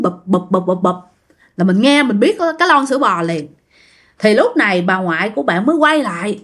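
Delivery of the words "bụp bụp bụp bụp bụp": short, separated thuds; bụp as a repeated sound effect; imitated thudding can. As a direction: mimic a tin can knocking along. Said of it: "là nó nghe cái tiếng".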